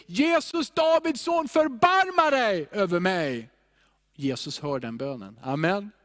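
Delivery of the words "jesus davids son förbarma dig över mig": high, urgent call for mercy; acted beggar's shout, pitched way up; full-voiced cry. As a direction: cry out to be heard through a crowd. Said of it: "det rop som kom ur en full röst".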